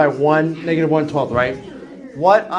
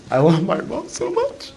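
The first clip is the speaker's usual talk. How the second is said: higher, hammed-up tearful gush; mock emotional; 3 semitones higher than usual. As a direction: pretend to choke up with love. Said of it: "I get very emotional".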